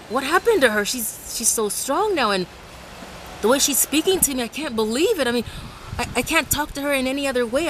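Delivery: high, rattled voice of a bully who lost his nerve; a bully, shaken and amazed; imitating a stunned bully. Bully baffled at her new firmness, high and rattled.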